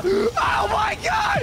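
drawn-out scared shout; panicked yell. Real, not acted. Team swarmed by bugs.

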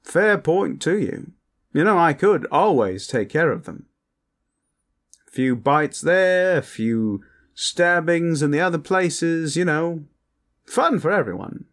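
gleefully wicked, high-pitched vampire; posh vampire. Acted. Vampire offering partner to take care of the elves, high and playful.

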